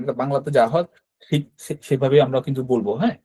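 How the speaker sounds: normal talking voice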